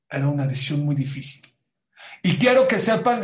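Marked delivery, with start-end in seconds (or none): 2.2-3.2 emphatic. Acted. Emphatic address to audience.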